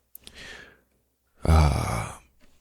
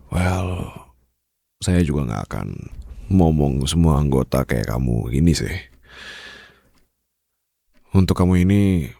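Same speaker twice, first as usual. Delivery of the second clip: ordinary talk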